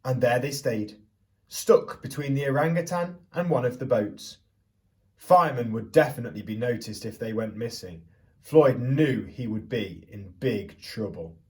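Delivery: put-on lively storyteller voice for children; picture book read-aloud. A narrator's reading. Teacher reading Stuck to the class, storytelling voice.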